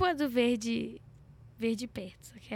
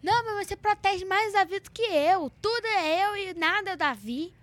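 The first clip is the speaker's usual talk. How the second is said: put-on higher whiny kid protest; whiny sibling complaint; 7 semitones higher than usual. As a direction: complain like a jealous sister. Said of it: "isso eu falo demais".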